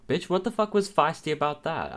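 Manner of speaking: high, loud and indignant. Real, not acted. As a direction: snap back in disbelief. Indignant at caller's remark.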